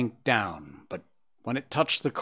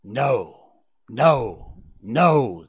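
firm, punched refusals; restrained yell, growing louder; 5 dB louder, much slower than usual. A narrator's reading. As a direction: shout him down, at usual pitch. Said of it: "the dog yelled".

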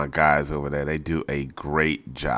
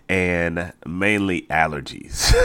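ordinary talk

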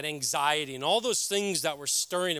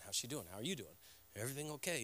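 much softer, lower, tossed-off repeat of the questions; weary mimicry of askers; 4 semitones lower, 15 dB quieter, faster than usual. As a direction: murmur them as if worn down by hearing them. Everyone asking, mimicked wearily.

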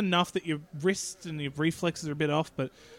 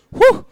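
high loud whew; 15 dB louder than usual. Real, not acted. Reacting to a crude joke.

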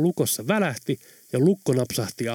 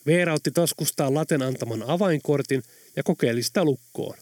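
plain reading voice

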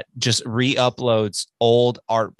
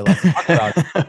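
laughing; 4 semitones higher, 5 dB louder than usual. Real, not acted. Laughs at own remark.

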